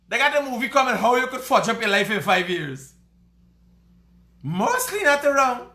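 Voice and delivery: high, loud Creole character voice; gleeful squealy voice with laughter; put-on radio announcer. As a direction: play a giggling, shrill comic announcer. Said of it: "radio announcers".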